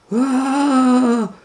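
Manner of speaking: high startled yelp; monkey yelp. Acted. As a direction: squeal in surprise as you're lifted. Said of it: "George was like".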